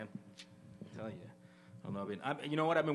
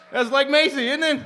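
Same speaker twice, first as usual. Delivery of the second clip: excited shout; 13 semitones higher, 17 dB louder than usual. Real, not acted. Excited after impression.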